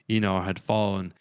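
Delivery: plain reading voice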